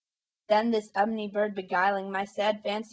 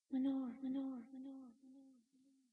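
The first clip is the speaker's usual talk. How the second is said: faint, fading echo; echoing murmur; 3 semitones higher, 16 dB quieter than usual. A narrator's reading. Echo murmuring the name back to the narrator. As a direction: let it die away like an echo, slowly. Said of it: "an echo murmured back the word".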